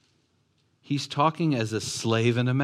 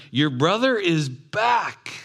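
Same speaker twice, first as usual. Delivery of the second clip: slow, loud, high announcement; excited news; 8 semitones higher, 5 dB louder, slower than usual. Acted. Servant bursting in to tell the brother. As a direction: call out the good news. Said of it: "an excitement running by and sharing".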